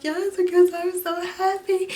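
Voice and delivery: high, tearful joyful cry; imitating herself crying with joy; happy tears. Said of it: "And I was like".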